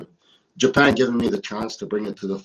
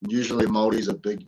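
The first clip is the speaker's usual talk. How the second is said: ordinary delivery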